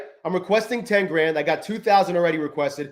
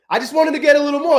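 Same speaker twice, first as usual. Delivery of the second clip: higher and fast, worked up; agitated; 10 semitones higher, 5 dB louder, faster than usual. Real, not acted. Trader explaining his greed to viewers, agitated and fast. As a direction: rush it out in frustration. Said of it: "I'm so fucking pissed about that still".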